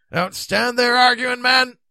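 high, loud impatience; loud, urgent reproach; exasperated order. A narrator's reading. Stranded knight loses patience.

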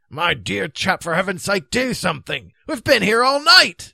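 loud, high exasperation; exasperated plea. A narrator's reading. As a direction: urge him impatiently, finishing in a shout. Stranded knight begs for help.

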